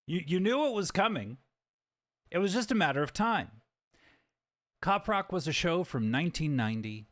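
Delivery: normal talking voice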